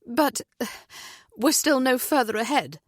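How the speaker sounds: anxious pleading mother. A narrator's reading. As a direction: push it up with rising worry. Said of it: "her heart racing".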